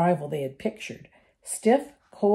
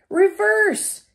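much higher, louder yell; 14 semitones higher, 8 dB louder than usual. A narrator's reading. Harry yells at the car to back away. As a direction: bark the order at the car. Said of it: "Harry yelled".

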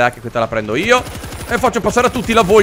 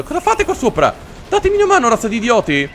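very high, indignant plea; exasperated yelling; 6 semitones higher than usual. Real, not acted. Player to idle teammates up above, exasperated yelling. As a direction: scold useless teammates. Yells at useless allies.

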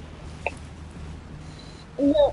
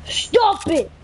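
hyped gamer bro; 9 semitones higher, much slower than usual. Real, not acted. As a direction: shout it out, frustrated. Yelling at teammate in game.

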